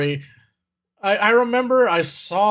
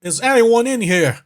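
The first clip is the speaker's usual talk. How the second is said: raised, hammed-up British challenge; bad British accent; 5 semitones higher than usual. British guy demanding rent from whatever lurks, in a bad British accent. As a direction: call out in a badly faked British accent. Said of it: "bad British".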